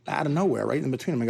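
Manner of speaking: ordinary talk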